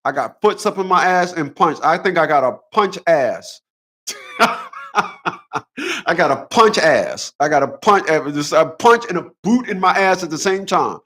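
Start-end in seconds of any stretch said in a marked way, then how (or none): 4.3-6.0 sudden high-pitched laugh; real amused laugh. Real, not acted. Laughs at own joke.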